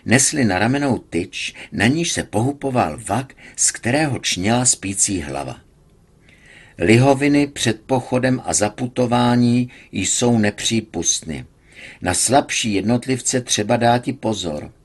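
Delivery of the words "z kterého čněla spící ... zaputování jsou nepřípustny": plain reading voice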